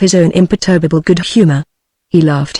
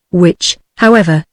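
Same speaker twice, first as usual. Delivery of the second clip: plain reading voice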